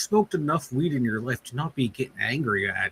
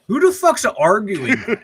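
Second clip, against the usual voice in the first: laughing disbelief; 10 semitones higher, 7 dB louder than usual. Real, not acted. Incredulous at the Bradshaw take.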